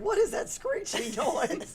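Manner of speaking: high, drawn-out appalled question; exaggerated disgust at your own singing; mock horror. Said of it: "it's like".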